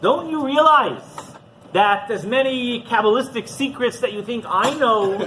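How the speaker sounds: slow, emphatic rebbe's exhortation; impassioned appeal. Acted. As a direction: drive the lesson home, loud and slow. Rebbe's impassioned lesson to the chassid.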